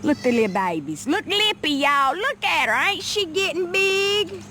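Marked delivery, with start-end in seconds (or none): 1.1-4.4 tender high coo. Real, not acted. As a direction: gush over the little goats. Cooing at baby goats.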